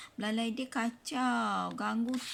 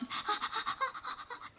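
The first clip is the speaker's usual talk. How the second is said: high breathy giggle; 5 dB quieter than usual. Acted. Reader laughs in high voice.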